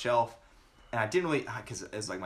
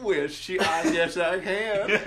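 exaggerated country twang with a laugh; teasing put-on Southern, last words drawn out; mock Southern accent; 5 semitones higher, much slower than usual. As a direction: play along in a hammed-up Southern accent. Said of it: "she doesn't know I'm fucking with her".

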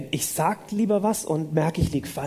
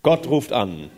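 lowered, lightly mock-solemn God voice; Charlton Heston as God; 5 semitones lower, slower than usual. Interpreter copies the parody of God calling, mildly mock-grand voice. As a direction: announce it as a film-epic God. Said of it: "Gott redet wie Charlton Heston".

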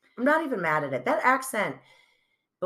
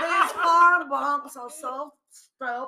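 loud, higher, slow, fake-tearful accent; dramatic Anna Delvey; 8 semitones higher, 4 dB louder, slower than usual. Mocking Anna Delvey stalling over her forty million dollar loan. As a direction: wail the excuse like a stranded heiress.

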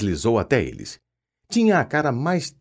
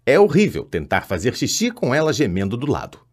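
ordinary delivery

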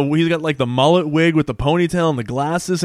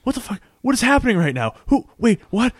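high-pitched mock confusion; retold bewilderment; 8 semitones higher than usual. Jack gets up alive amid the reveal, high-pitched baffled. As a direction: imitate someone utterly baffled, pitch shooting up.

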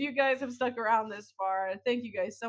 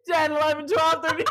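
counting through real laughter, higher and louder; laughing; 7 semitones higher, 5 dB louder than usual. Real, not acted. Podcaster counting body holes to co-host, laughing helplessly. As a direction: giggle helplessly while you keep talking.

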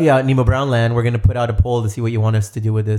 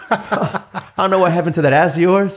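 laughing; 4 semitones higher than usual. Real, not acted. Teasing friend, laughing at pants.

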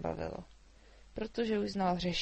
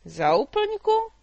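much higher, louder, very slow disbelief; astonished; 13 semitones higher, 13 dB louder, much slower than usual. A narrator's reading. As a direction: stretch it out in shock. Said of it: "zeptal se". (užaslý, protažený výkřik)

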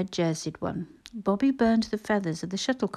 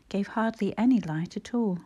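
plain reading voice

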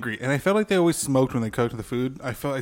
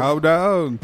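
usual speaking voice

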